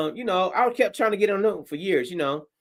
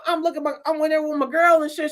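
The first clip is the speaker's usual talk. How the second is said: ordinary delivery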